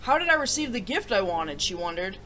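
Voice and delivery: gentle storybook reading; storybook narrator voice; story narration. Acted. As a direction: read it like a bedtime story to kids. Story read aloud to viewers.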